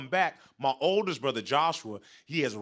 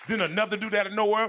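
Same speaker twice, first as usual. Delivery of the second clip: normal talking voice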